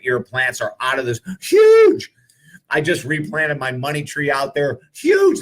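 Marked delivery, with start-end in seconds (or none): 1.4-2.1 much higher and louder squeal on huge; thrilled squeal. Real, not acted. Excited about his garden plants. 4.9-5.4 much higher and louder squeal on huge; thrilled squeal. Real, not acted. Excited about his garden plants.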